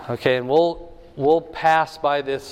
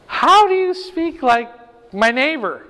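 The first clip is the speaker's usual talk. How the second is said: amazed question; 9 semitones higher, 4 dB louder than usual. Pretend. Acting amazed listener at Pentecost.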